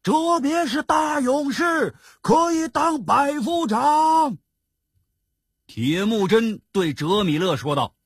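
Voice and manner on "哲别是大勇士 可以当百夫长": high, sustained crowd cheer. A narrator's reading. Soldiers hailing Zhebie's promotion, high cheering shout. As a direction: cry it out like rejoicing soldiers, slowing at the end. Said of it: "欢呼起来".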